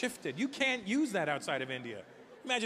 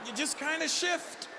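high exasperated punchline; 10 semitones higher, slower than usual. Acted. Punchline about crowding.

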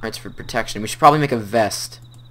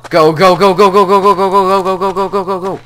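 frantic urging; 6 semitones higher, 6 dB louder than usual. Real, not acted. Urgent escape in game.

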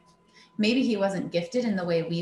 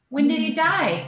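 put-on pinched nasal boy voice, higher; 8 semitones higher, 5 dB louder than usual. A narrator's reading. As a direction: push it through the nose. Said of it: "came Noah's nasal voice".

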